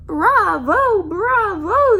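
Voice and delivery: loud, high "Bravo! Bravo!"; cheering. Acted. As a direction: cheer like a crowd. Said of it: "they cheered".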